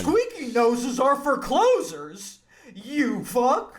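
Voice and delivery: high, slow clown sales boss; gruff clown boss. Acted. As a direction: snarl it like a clown closer. Clown boss parody line.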